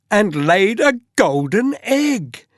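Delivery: ordinary delivery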